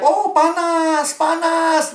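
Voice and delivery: high, drawn-out mock wail of a burning devil; hammed-up demon crying out in pain, high and slow; shrieking devil. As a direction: wail it like a movie devil being scorched. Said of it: "nanti iblisnya teriak".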